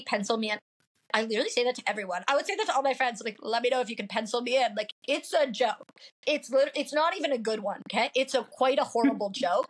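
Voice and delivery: usual speaking voice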